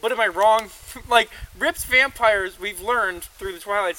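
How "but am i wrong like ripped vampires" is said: excited. Real, not acted. Excited pushback with laughter.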